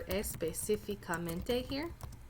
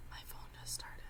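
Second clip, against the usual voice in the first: whispered aside; 15 dB quieter, faster than usual. Real, not acted. Phone assistant set off.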